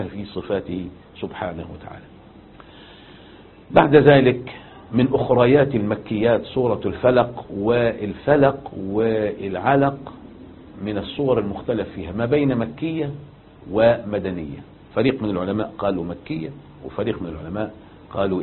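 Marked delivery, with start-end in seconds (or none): none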